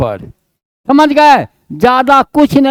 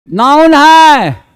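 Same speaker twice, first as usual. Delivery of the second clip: drawn-out, stressed है; slow checklist; much slower than usual. Shown as a demonstration. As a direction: stretch out noun है as a checklist item. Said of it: "ये जो है". (धीमे, खींचकर जाँचते हुए)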